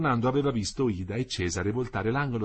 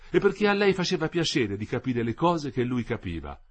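plain reading voice

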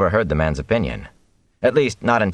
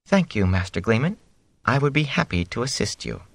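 fake calm; 5 semitones higher than usual. A narrator's reading. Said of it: "spoke in a too calm voice".

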